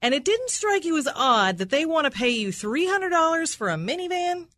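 high, incredulous cross-examination. Real, not acted. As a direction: press the witness with rising disbelief. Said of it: "she's using sort of a higher-pitched voice".

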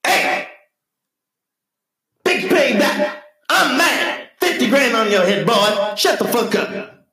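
high, raspy soul-shouter mimicry; hammed-up high-pitched scolding. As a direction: holler it like an irate funk legend. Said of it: "thought of [name] in heaven going".

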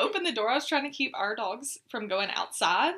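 talking through loud laughter; laughing. Real, not acted. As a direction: laugh as you tell it. Laughing while retelling the scene.

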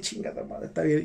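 under-the-breath whispered swear; whispered curse. Retold or imitated. Retelling giving in to the witch's ritual, whispered curse. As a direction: hiss it to yourself, resigned. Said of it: "y pues ya dije".

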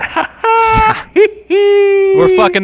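high excited protest. Real, not acted. Co-host squealing back the poodle line, high and loud. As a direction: squeal it in disbelief.

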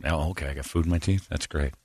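soft relieved reply; quiet. Retold or imitated. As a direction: say it under your breath. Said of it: "and says".

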